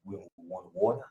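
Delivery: soft, low whiny asking; mock small request. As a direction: imitate shy drivers offering. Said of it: "remember how they was".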